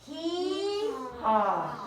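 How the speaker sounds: braying hee-haw like a donkey. Acted. Teacher leading the class, braying like a donkey. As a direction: bray it out for the children. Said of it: "everybody says".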